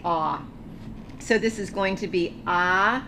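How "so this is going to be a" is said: normal talking voice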